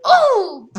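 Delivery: high, loud cry of frustration; loud frustrated squeal. Real, not acted. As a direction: let out an angry squeal.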